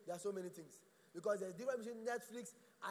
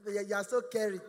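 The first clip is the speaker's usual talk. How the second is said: loud, gushing mock girlfriend; coy mock sweetness; swooning girlfriend; 2 semitones lower, 10 dB louder, slower than usual. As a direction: gush over Kelvin, hammed up. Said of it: "she will tell you".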